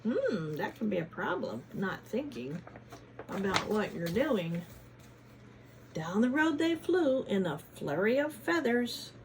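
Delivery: ordinary delivery, nothing marked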